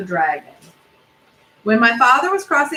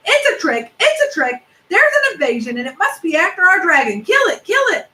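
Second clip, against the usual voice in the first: yelling animals; 9 semitones higher than usual. A narrator's reading. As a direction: scream it up high like an angry mob. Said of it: "all yelling".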